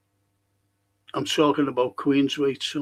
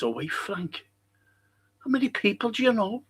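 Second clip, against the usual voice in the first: light, quick mock American accent; 6 semitones higher, 4 dB quieter than usual. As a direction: toss it off in a half-hearted American accent. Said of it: "In an American accent, by the way".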